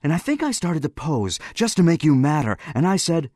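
high, eager, happy recollection. A narrator's reading. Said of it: "He was very happy".

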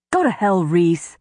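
angry snap at Rhys; snapped. A narrator's reading. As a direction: snap it back. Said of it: "Lucian snapped".

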